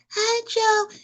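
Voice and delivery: put-on tiny, soft, high breathy-child voice; a timid falsetto hello. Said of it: "didn't talk like that".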